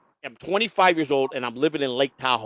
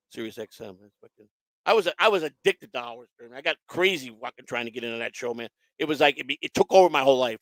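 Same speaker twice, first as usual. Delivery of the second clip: ordinary delivery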